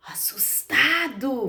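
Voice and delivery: slow frightened whisper, drawn out; scared whisper. Shown as a demonstration. Teacher showing children a scared face, whispering slowly. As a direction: breathe the word like you're scared. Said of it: "como que está essa expressão?".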